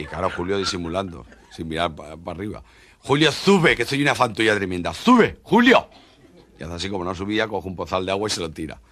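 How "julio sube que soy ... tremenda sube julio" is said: pleading shout, rising high on sube; llamada insistente. Acted. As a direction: coax him up with loud calls. Llamando otra vez a Julio. (llamada suplicante y alta)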